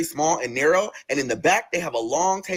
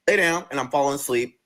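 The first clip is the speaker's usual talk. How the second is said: usual speaking voice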